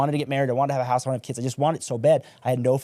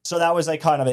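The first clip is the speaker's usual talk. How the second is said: ordinary talk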